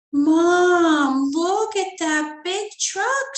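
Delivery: high, drawn-out call to mom; shouted. Acted. Girl calling her mom about the moving truck, shouting. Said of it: "she shouted".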